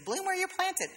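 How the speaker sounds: high, singsong mock of a saying; dismissive high-pitched mimicry; mocking a platitude. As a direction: parrot the stupid saying in falsetto. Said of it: "that stupid thing humans say".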